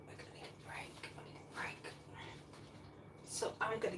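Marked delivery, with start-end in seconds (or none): 0.1-1.9 breathy whispered plea. Real, not acted. Vlogger whispering to viewers she needs rest. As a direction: whisper it like a secret wish.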